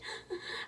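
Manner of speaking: imita el hipo del llanto; un suspiro entrecortado de niño; sollozo imitado. Shown as a demonstration. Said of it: "así de". (imita el puchero del niño, sin voz)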